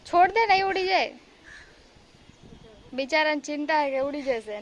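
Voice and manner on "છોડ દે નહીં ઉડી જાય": loud coaxing call. Real, not acted. Mother calling loudly to her son who clings to the glasses, high and loud. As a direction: raise the voice to make him let go.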